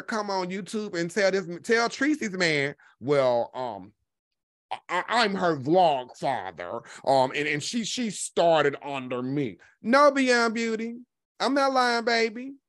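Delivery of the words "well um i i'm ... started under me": low, slow, halting self-introduction; mock his ponderous claim, dragging out the words; Jay Wilson impression. Commentator mimicking a man introducing himself to Tracy's man, slow mocking impression. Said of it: "Jay Wilson hit that man's panel and introduced himself".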